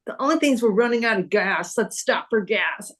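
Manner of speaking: ordinary talk, nothing marked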